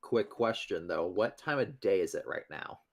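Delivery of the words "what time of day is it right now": normal talking voice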